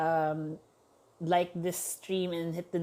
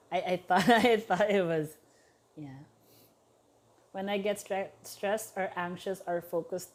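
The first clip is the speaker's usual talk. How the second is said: ordinary talk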